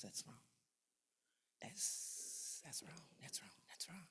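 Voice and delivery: unvoiced whispered "that's wrong". Real, not acted. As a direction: breathe "that's wrong" under your breath. Whispers disapproval aside.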